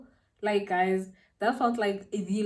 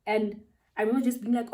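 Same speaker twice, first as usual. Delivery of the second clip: usual speaking voice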